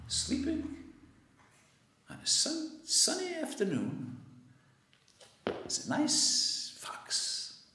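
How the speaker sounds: soft and high, hushed; hushed storytelling. A narrator's reading. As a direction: tiptoe past a sleeping fox. Quiet description of sleeping fox.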